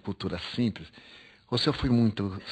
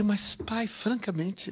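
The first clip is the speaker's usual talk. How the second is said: much higher, bewildered plea; incredulous protest; 11 semitones higher, much slower than usual. Retold or imitated. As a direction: lift it high as if you can't believe it. Son protesting to dying father.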